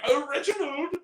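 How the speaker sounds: high-pitched cartoon English accent; over-the-top English accent, climbing high; exaggerated English accent. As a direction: squeal it as a caricatured English toff. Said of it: "like, an exaggerated English accent".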